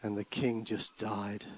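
rhythmic, rising and falling recital; dramatic poem reading. Acted. Preacher reading a poem to church, dramatic. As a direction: read the ballad like a storyteller.

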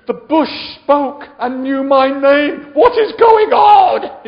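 high, slow hammed-up panicked shout; freaking out. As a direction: wail in mock shock at a talking bush. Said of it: "I'd freak out".

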